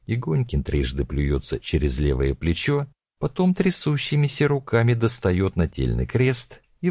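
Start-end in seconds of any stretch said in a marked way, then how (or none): none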